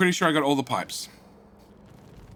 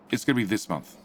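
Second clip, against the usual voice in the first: ordinary talk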